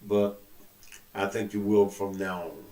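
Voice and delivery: ordinary talk, nothing marked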